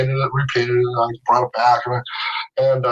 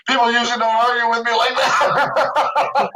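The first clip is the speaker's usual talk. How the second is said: laughing quote; 6 semitones higher than usual. Retold or imitated. Quoting Spielberg amused.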